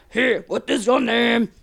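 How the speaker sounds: slow mock French official; drawn-out question in a put-on French accent. Census man asking the German, hammed-up French accent. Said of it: "Is this your French accent".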